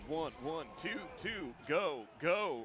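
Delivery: chanting like a cheesy keyboard DJ; keyboard DJ mode voice. Retold or imitated. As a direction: call it out like a toy keyboard announcer. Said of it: "you set it to the D J mode".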